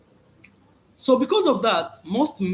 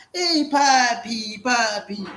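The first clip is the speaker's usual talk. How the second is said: slow, seductive cooing, higher; flirty Colombian woman; 4 semitones higher, 4 dB louder, much slower than usual. Retold or imitated. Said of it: "Sitting on their lap".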